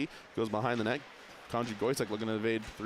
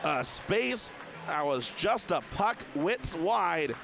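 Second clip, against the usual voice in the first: rapid hockey play-by-play; 6 semitones higher, slower than usual. Real, not acted. Live hockey commentary.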